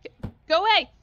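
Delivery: loud high dismissal; loud exasperated shoo; shouted go away. Real, not acted. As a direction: yell at the customer. Shouting at customer.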